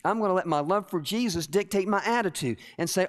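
ordinary talk, nothing marked

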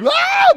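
very high, loud shriek; horrified shriek. Retold or imitated. Watching the water break, horrified shriek. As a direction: scream at something gross. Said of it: "it was like".

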